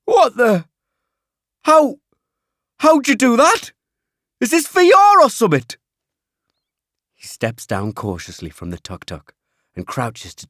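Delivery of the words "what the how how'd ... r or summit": high, loud Geordie disbelief; stunned Geordie shout; astonished. A narrator's reading. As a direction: stammer it out in amazement. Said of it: "his eyes wide with astonishment".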